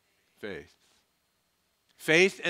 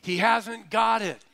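calling across; 9 semitones higher, 6 dB louder than usual. Retold or imitated. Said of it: "she goes".

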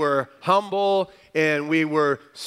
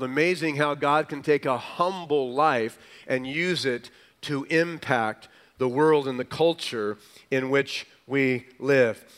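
ordinary talk

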